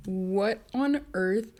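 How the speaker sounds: normal talking voice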